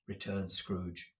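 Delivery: usual speaking voice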